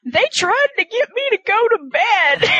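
high-pitched mock complaint; indignant Alma. Teller imitating Alma refusing bedtime, indignantly.

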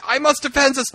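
mock noble Hermione. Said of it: "Hermione's like".

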